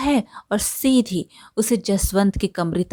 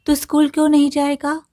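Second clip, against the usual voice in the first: worried surprise; 7 semitones higher, 3 dB louder, faster than usual. A narrator's reading. Mother asks son why.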